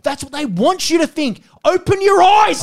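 high voice building to a yell; exasperated shouting. Real, not acted. Podcaster warns co-host about sneaky Kiwis, exasperated shouting. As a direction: yell it as an urgent warning.

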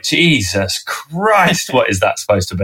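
long drawn-out, appalled groan of words; mock exasperation. Guest voices Manchester viewers hearing the bad accent, exasperated. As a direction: stretch it out slowly in mock despair.

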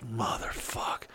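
breathed out with no voice, defeated; whispered curse. Retold or imitated. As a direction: hiss it under your breath in despair. Despair, stranded on the highway.

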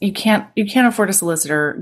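ordinary talk

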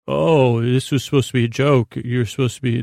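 ordinary talk

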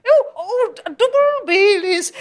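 high, slow mock-Irish brogue; comically overdone stage Irish; hammed-up Irish accent. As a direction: stretch out a silly Irish voice. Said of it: "if I say it like that".

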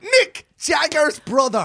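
high, drawn-out exclamation; triumphant reveal. Real, not acted. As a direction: stretch out the big reveal in excitement.

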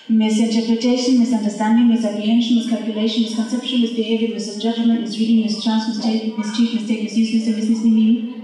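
driving recital of mis- words; rhythmic word list. Acted. Performer rattling off mis- words, building.

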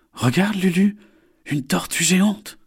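high, eager cry of wonder; excited exclamation. Acted. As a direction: point it out, thrilled. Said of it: "s'exclame Isor".

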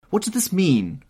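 loud, high demand; crying out. A narrator's reading. Painter demands meaning of the portrait, crying out. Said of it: "cried Hallward".

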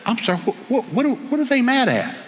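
high-pitched, stammering bewilderment; baffled protest. Acted. Man answering screaming topless protesters, baffled protest.

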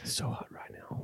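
breathy unvoiced whisper. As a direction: whisper it like a secret. Quoting a catchphrase.

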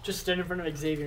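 ordinary delivery

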